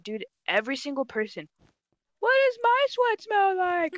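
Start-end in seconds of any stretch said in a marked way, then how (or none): 2.2-3.9 high, drawn-out mimicking voice; slow, singsong mock of pestering players; mocking imitation. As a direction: parrot the question in a silly high pitch. Mimicking players who repeat the joke.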